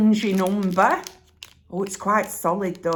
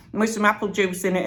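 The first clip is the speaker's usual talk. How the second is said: normal talking voice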